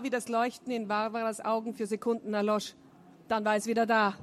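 plain reading voice

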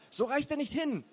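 high, loud strained calling; urgent call. A narrator's reading. Peer by the fire with too short a hose, urgent call. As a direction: shout it over in alarm. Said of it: "rief Peer".

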